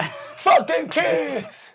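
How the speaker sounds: high, drawn-out comic yell; defiant shout. Pretend. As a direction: holler it slowly at full voice. Shouted retort to yelling complaint.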